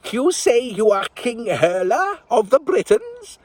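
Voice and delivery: put-on high, slow crowing old woman; crowing old lady. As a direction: crow it like a delighted old witch. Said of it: "the sweet old lady crowed".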